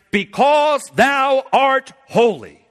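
high, loud and slow, every word drawn out; fervent proclamation. Retold or imitated. Preacher voices Christ's answer to the Father, slow and loud.

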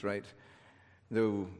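ordinary talk, nothing marked

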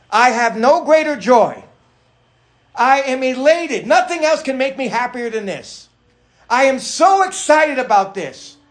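loud, high, emphatic joy; elated. Acted. Preacher reading a father's joy in his children, elated. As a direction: proclaim it with big preacherly excitement. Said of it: "get all excited about things".

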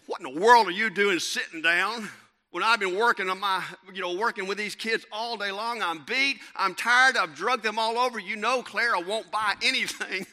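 loud, high-pitched mock tirade; exasperated wife. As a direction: blow up like a worn-out, indignant wife. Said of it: "She said".

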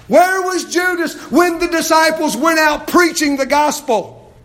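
loud, high preaching cry; fiery preaching. Acted. Pastor urging the congregation, preaching loud and high. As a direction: preach it out with full force.

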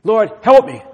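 loud, high urgent plea; crying out to God. Acted. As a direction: beg God aloud for help. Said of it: "this cry of".

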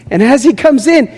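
ordinary talk, nothing marked

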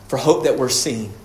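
ordinary talk, nothing marked

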